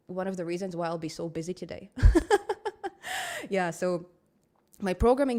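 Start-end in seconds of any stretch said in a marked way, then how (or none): 1.9-3.4 loud laugh. Real, not acted. Laughs about being busy.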